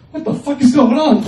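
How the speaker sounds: loud, high startled yell; startled outburst. Retold or imitated. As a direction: blurt it out, alarmed. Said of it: "the person behind them is like".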